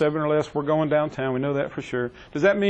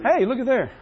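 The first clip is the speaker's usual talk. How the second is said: playful mock praise; teasing; 9 semitones higher, 3 dB louder than usual. Real, not acted. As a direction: tease him with raised voice.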